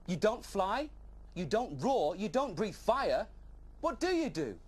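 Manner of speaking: high, slow, incredulous list; can't believe it, what do you do; young dragon puzzled by the Benjamin; baffled questioning. Acted.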